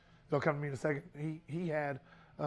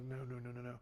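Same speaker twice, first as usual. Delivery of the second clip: stunned quiet denial; 4 semitones lower, 11 dB quieter, faster than usual. Retold or imitated. Retelling news of brother's death.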